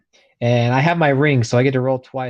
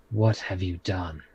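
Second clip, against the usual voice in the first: quiet dismay; 6 semitones lower, 6 dB quieter than usual. Acted. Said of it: "crouch down next to him and say".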